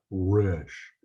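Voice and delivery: drawn-out Resh with an English R, lips in front; lip-made English R. Shown as a demonstration. Teacher showing the English speaker's mistake, lip-made R in Resh. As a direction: say Resh slowly with a rounded English R. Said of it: "not with your lips in the front".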